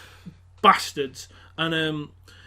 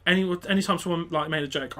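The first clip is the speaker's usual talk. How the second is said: normal talking voice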